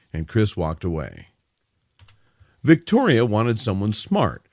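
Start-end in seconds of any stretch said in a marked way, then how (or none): none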